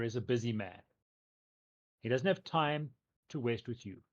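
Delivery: a hint of a blunt, pushy townsman; lightly voiced tough brother warning them off; gruff brother. GM as the mayor's brother warning the party away, lightly gruff.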